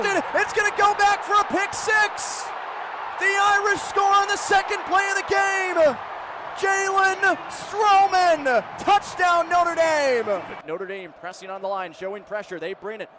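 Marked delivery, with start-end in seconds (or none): 0.0-10.4 high, loud shouting with a stretched name; excited pick six call. Real, not acted. Announcer shouting an interception return touchdown. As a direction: let it rip on the interception return.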